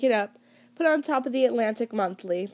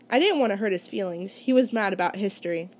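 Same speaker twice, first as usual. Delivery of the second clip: plain reading voice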